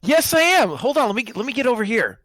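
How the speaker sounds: high, excited recruit voice; eager newbie. Acted. Recruit joins gamblers at the table, high eager newbie. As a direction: jump in eagerly, pitched right up.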